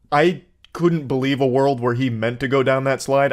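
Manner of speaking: usual speaking voice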